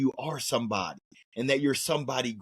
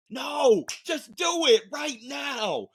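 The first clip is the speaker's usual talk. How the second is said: shouting; 10 semitones higher, slower than usual. Real, not acted. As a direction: yell it at them, slow. Urgent command to listeners.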